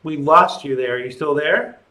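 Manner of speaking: normal talking voice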